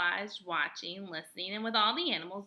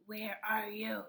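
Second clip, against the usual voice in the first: frog croak; 4 dB quieter, slower than usual. A narrator's reading. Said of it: "One would croak".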